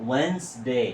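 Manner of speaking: "ওয়েডনেসডে" as wens-day, silent d; correct Wednesday. Shown as a demonstration. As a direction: model "ওয়েডনেসডে" in two syllables, WENS-day. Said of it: "এটা হবে ওয়েডনেসডে".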